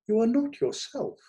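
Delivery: concerned. Acted. Delia reproaches him.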